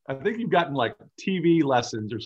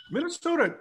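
"Minnesota" jumped much higher, sing-song; mock Minnesota accent. Shown as a demonstration. Host teases the player's home state, mock Minnesota lilt. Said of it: "growing up in Minnesota".

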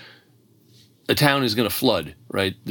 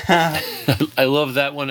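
laughing through the words, slow; 6 semitones higher, much slower than usual. Real, not acted. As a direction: chuckle it out slowly. Laughs while reacting to story.

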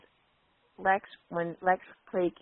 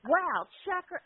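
higher, admiring wow; impressed guys; 11 semitones higher, slower than usual. Retold or imitated. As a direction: guys admiring a woman, lightly voiced. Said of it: "all the guys are like".

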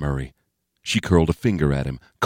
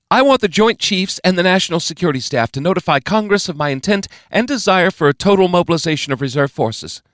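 higher, louder, firm orders; commanding President; 12 semitones higher, 4 dB louder than usual. A narrator's reading. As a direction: demand action immediately. President issues further orders.

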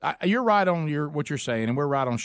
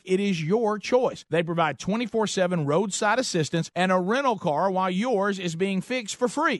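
ad read; 2 semitones higher, slower than usual. Acted. Host reads a live ad.

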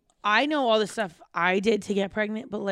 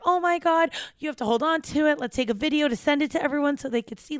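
high, fast, urgent excitement; excited mother; 6 semitones higher, 3 dB louder, faster than usual. Retold or imitated. Mother telling daughter to save tooth for a video, retold excitement. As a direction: gush in a rush, pitched up.

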